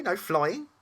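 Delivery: high, loud rising question; astonished, dismayed demon query; incredulous. Acted. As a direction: squeak it in disbelief, pitch shooting up. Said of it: "it asked incredulously".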